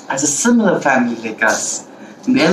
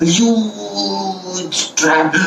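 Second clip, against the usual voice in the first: higher, with HUGE stretched long; dramatic drawn-out emphasis; 7 semitones higher, much slower than usual. A narrator's reading. Storyteller describing the hunter's trap, drawn-out emphasis. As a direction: draw out "huge" to show the size.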